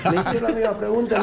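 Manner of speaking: ordinary talk, nothing marked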